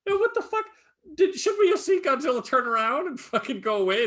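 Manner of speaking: high, exasperated disbelief at the ending; real incredulous rant; incredulous outburst. Real, not acted. Indignant rant about the movie.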